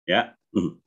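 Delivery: usual speaking voice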